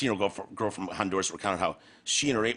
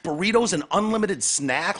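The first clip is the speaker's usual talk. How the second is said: mock disbelief; 8 semitones higher, 3 dB louder than usual. Real, not acted.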